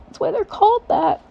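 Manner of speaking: high, squeaky put-on voice; mock reply. Answering people amazed that boxers box, high mock reply. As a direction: squeak it out like it's obvious.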